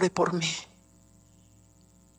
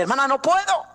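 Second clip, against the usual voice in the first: put-on pleading girl; 8 semitones higher, 5 dB louder than usual. Girl refuses, quoted by preacher.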